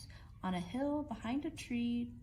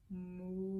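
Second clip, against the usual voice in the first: cow moo; 2 semitones lower than usual. Acted. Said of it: "what do you think she says?".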